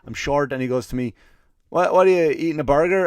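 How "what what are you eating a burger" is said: louder, higher mock voice of the pestering guy; imitating the guy. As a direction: put on a nosy stranger's voice. Retold guy interrupting his meal.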